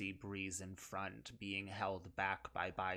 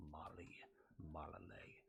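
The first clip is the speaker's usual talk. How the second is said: whisper; 4 semitones lower, 11 dB quieter than usual. Acted. Villain whispers names of dead.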